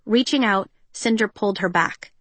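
plain reading voice

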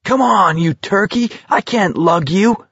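high, strained, exasperated urging; hissed urgently. A narrator's reading. McVries dragging Garrity to his feet, hissing in exasperation. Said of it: "McVrees hissed".

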